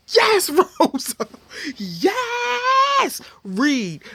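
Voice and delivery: loud, high, drawn-out whoop of approval; shrieking hype with stretched-out words; hyped cheering. Retold or imitated. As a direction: cheer her on at a shout. Cheering on Rosa's comeback.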